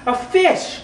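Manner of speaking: high squeaky leap on "a fish"; sound poetry. Acted. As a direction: jump way up for "a fish". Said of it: "a sound poem by me".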